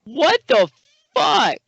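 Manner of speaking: high, loud disbelief; real indignant shock; shocked outrage. Real, not acted. Reacting to blackface comments.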